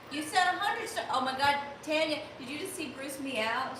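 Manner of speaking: high, loud excited squeal; thrilled at a big gift of stars; delighted outburst. Real, not acted. Excited reading of a big donation.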